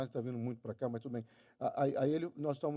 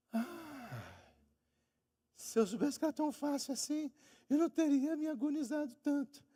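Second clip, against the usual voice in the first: much higher, mock-relieved student voice; 11 semitones higher than usual. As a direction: squeak it out like a relieved kid. Student relieved after getting ten. (estudante aliviado com voz bem aguda, de brincadeira)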